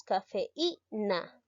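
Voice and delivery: cafeína drawn out, the í stressed; slow pronunciation demo. Shown as a demonstration. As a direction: pronounce cafeína slowly and clearly.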